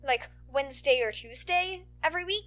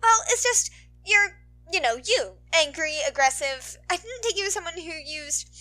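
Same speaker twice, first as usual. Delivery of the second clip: put-on teasing girl voice; 5 semitones higher, 5 dB louder than usual. Ochaco teasing Bakugo.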